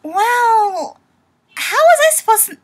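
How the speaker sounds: high, loud, rushed self-defence; indignant protest. Acted. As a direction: protest loudly as the accused character. Acted defence of choking on water.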